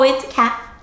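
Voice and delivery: normal talking voice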